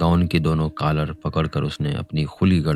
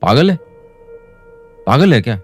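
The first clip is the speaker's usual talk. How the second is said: sharp, louder angry rebuke; scolding; 3 semitones higher, 4 dB louder, faster than usual. A narrator's reading. Chandar scolding the old man who grabbed him, angry. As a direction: snap at him with a threat. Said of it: "डांट कर बोला".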